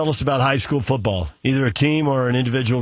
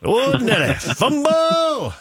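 high, drawn-out folksy voice; 11 semitones higher, much slower than usual. Pretend. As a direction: ham up a slow folksy drawl. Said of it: "I'm gonna have to get super folksy".

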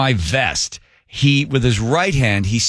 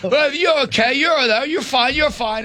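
much higher, placating voice; mock soothing operator; 13 semitones higher than usual. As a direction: brush off his anger. Operator soothing the angry jumper.

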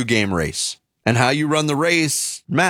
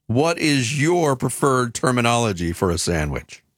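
usual speaking voice

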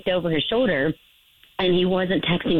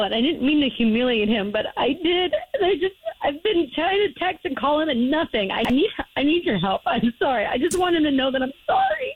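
tearful; 5 semitones higher than usual. Real, not acted. Caller breaks down apologizing on air.

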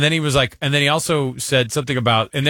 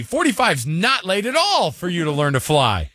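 higher, emphatic reassurance; upbeat encouragement; 6 semitones higher than usual. Retold or imitated. Reading an answer that says it's fine to learn flying, upbeat. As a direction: read it out brightly encouraging, pitched up.

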